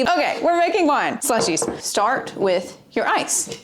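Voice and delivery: normal talking voice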